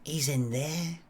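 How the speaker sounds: soft, hushed Blackpool woman's voice; Blackpool accent. Acted. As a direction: say it quietly in a put-on Blackpool accent. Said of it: "is that a Blackpool accent you've got".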